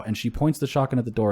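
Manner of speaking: ordinary talk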